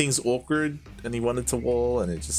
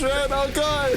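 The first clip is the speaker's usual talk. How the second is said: excited; 14 semitones higher, slower than usual. Real, not acted. Exclaims at siege.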